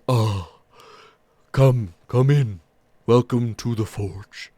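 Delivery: slow, welcoming big ogre voice; gentle ogre smith. Acted. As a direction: greet them warmly, slowly, like a giant.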